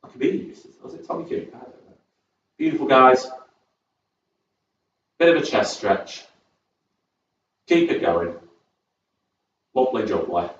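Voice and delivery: ordinary delivery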